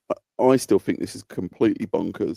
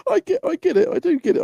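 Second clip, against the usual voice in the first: laughing. Real, not acted. Laughing at his own stubbornness.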